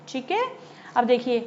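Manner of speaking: ordinary delivery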